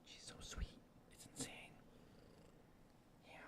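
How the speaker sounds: hushed adoring whisper. Real, not acted. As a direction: whisper so the purr shows. Whispering near purring cat.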